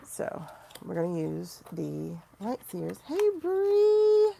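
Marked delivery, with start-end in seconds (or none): 3.1-4.3 high, loud call across the room. Real, not acted. Calling out to Bree as she logs off, loud. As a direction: call out to a friend, bright and loud.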